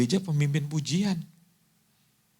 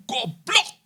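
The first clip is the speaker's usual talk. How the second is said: higher-pitched shouted insult; angry driver's insult; 11 semitones higher than usual. Retold or imitated. Startled driver cursing a wrong-way motorist, angry yell. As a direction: yell it out at someone on the road.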